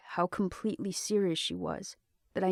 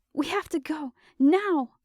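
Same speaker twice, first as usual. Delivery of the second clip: urgent; 9 semitones higher, 5 dB louder than usual. Acted. Panicked urgency.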